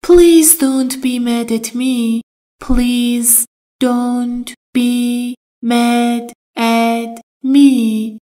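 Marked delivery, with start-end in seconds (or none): 2.6-8.2 very slow, drawn-out teaching model; slow drill. Shown as a demonstration. Teacher modelling the phrase very slowly for students. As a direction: drag out every word for learners. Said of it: "Mad at someone یعنی".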